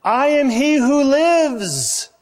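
high, slow, building to a shout on dead; slow it down and let dead ring out; dramatic reading. Acted. Preacher reading Jesus' words to the church, slow and shouted.

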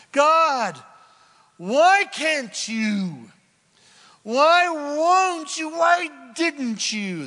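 high, loud, slow anguished protest; pleading complaint to God. Acted. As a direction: cry out at God, why won't you. Voicing complaining humans to God.

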